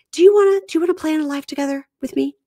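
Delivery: high, fast, pleading mock wife; high, fast, eager wife impression; mock eager plea. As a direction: rush out the question, high and hopeful. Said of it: "So she says".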